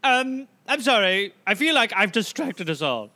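usual speaking voice

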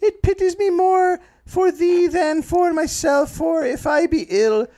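very high, slow Irish lament; accidental Irish accent. Acted. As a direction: draw it out in a piping put-on Irish voice. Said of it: "I'm doing Irish".

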